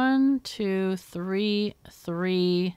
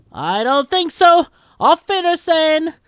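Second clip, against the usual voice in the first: defiant hero; 10 semitones higher, 12 dB louder than usual. Acted. Hero refusing the villain and bidding farewell, defiant. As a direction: throw the farewell out grandly. Hero's parting line from script.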